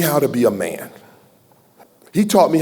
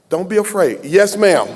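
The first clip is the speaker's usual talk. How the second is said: usual speaking voice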